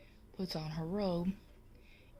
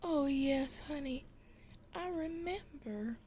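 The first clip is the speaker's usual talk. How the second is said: fond wife; 9 semitones higher than usual. Acted. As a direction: answer sweetly as the touched wife. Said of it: "She says".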